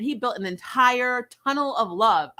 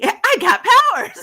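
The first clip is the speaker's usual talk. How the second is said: much higher and louder, laughing through a gleeful brag; excited King; 13 semitones higher, 9 dB louder than usual. Retold or imitated. King showing off his powers to Luce, high gleeful laugh. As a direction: crow about the new power like a thrilled little creature.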